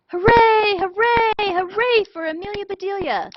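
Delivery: high, loud cheering cry. Acted. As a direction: cheer her like a hero. Team cheering a loud roar.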